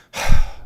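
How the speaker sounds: loud exasperated sigh, breathed out hard; impatient sigh at an unwanted visitor; annoyed sigh. Retold or imitated. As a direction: huff it out like you're fed up. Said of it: "the first thing that you would get was".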